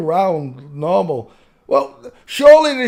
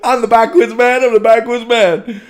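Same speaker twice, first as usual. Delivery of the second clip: higher, louder mock chant of the clip; mimicking backwards man; 6 semitones higher, 6 dB louder than usual. Host parroting a silly clip, loud mimicry.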